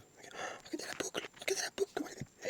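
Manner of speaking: hushed, voiceless marvelling; excited stage whisper; awed whisper. Pretend. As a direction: whisper in wonder at a tiny find. Marvels at a curl.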